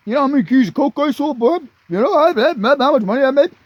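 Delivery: mock cocky young dealer; bragging punk. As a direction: puff up like a swaggering kid dealer. Imitating bragging nineteen-year-old punk.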